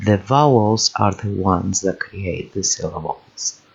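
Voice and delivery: normal talking voice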